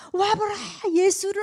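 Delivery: high, loud street call to come and see. Retold or imitated. Evangelist calling passers-by to come see Jesus, loud.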